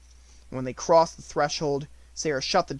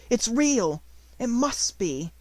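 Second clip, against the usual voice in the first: excited; 9 semitones higher, slower than usual. Acted. Clutching the robe, overjoyed.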